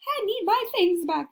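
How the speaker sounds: high puppet voice asking for things back. Pretend. Puppet to class, high pleading voice. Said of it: "they say".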